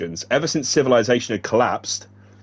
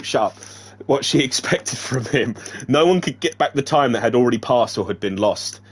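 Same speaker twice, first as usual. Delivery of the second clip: plain reading voice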